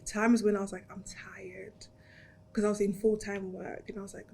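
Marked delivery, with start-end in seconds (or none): 0.9-1.7 breathy, near-whispered tiredness; drained, hushed retold complaint; weary. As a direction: let it out softly, worn out. Retells being worn out.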